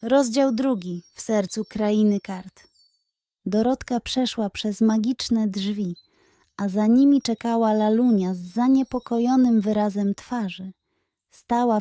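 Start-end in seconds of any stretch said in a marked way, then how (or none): none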